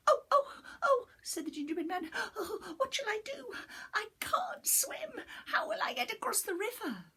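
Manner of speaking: high, squeaky, fast panicked little voice; a tiny cookie in a flap; high panicky little gingerbread man; frightened gingerbread man. Acted.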